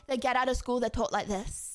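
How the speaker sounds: ordinary talk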